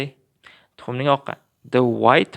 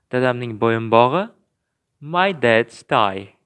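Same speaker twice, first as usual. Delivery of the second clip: ordinary talk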